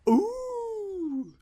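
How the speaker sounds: very high held audience "ooh"; mock crowd ooh. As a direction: coo like an amazed studio crowd. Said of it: "the crowd would go".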